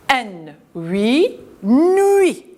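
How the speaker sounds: teacher sounds out N huit, nuit; blending into nuit. Shown as a demonstration. Teacher to children, blending N huit into nuit. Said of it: "On a la lettre muette".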